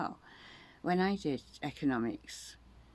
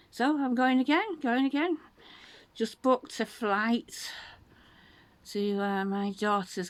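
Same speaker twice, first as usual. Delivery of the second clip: higher and louder, bursting with news; excited; 9 semitones higher, 5 dB louder than usual. Real, not acted. Telling a friend about her next trip, excited and louder. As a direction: announce it like you can't hold it in.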